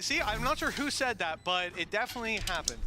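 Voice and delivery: normal talking voice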